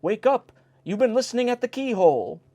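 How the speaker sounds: the host shaking the footman awake, angrily; angry shout. A narrator's reading. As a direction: rouse the sleeping footman sharply. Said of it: "exclaimed angrily".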